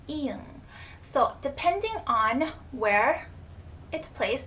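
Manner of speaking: usual speaking voice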